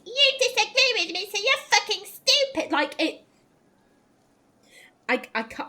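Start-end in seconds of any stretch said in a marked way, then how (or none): 0.0-2.7 high mock of a bratty comeback; childish insult. Imitating Sera's only argument, a high childish jeer. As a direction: throw it like a sulky kid, pitched well up. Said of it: "The only argument you have is".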